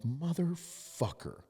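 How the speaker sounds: long groaning motherfucker; dismayed curse. Retold or imitated. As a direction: stretch the swear out in dismay. Realising the injury is bad.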